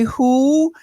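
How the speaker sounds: high, blunt one-word "Who?". Retold or imitated. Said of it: "And [name] would say".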